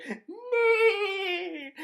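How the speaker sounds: high, loud call; shrill mock call. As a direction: call her name into the dark.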